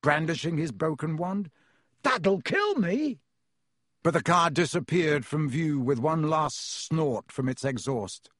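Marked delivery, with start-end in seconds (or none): 2.0-3.2 high, slow, stretched wail; yell. A narrator's reading. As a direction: call it out in high dismay. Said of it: "Ron yelled after it".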